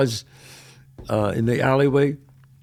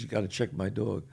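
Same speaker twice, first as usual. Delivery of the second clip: matter-of-fact request; 5 semitones lower, 10 dB quieter than usual. Retold or imitated. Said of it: "the guy comes in and says".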